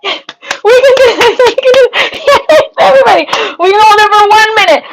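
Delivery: high, tearful cheering with laughs; excited rallying. Real, not acted. Streamer telling voters to keep it going, excited and urgent. Urging supporters through last minute.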